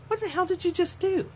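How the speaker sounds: high-pitched mock-indignant teacher; retold outburst of disbelief, voice shooting up high; incredulous scolding. Guru to student after the purse snatching, incredulous mock scolding.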